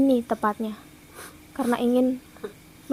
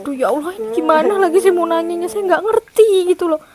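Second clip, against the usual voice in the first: fast, higher and louder in helpless confusion; flustered exclaiming; 6 semitones higher, 5 dB louder than usual. Real, not acted. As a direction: blurt it out, at a loss. Bingung tak bisa tanya hantu. (bingung, cepat dan lebih keras)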